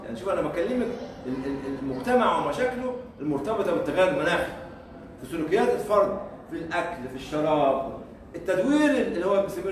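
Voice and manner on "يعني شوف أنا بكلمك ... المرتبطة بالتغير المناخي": ordinary talk, nothing marked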